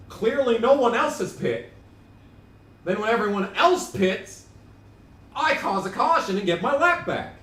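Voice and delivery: high, loud, worked-up complaint; exasperated rant. Real, not acted. Streamer ranting about pit stops and cautions, exasperated high shouting. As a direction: rail at the game's unfairness.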